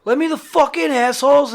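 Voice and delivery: high, loud yell through the door; mock yell. Pounding on the bandmates' hotel door, high mock yell. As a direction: holler to be let in, hammed up. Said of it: "I'm banging on the fucking Grinder's door and I'm like".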